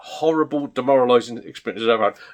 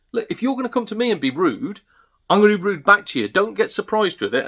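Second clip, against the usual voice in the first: higher-pitched retold telling-off; indignant comeback; 8 semitones higher than usual. Stallholder to a rude reseller, indignant. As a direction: act out standing up for yourself.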